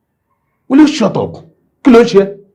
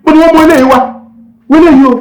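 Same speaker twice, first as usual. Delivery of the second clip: high, loud furious Yoruba rant; ìbínú gbígbóná; enraged rant; 11 semitones higher, 3 dB louder, much faster than usual. Acted. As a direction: curse her in fury. Father rages at daughter.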